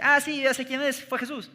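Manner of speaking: a hint of a small child blurting the answer; eager little boy. Little boy passing by answers the teacher, eager kid voice.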